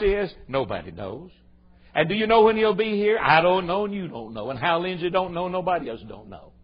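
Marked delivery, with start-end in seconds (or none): none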